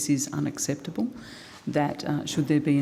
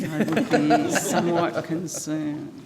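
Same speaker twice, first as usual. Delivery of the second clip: laughing; 3 dB louder than usual. Real, not acted. Joking about abseiling staff safety.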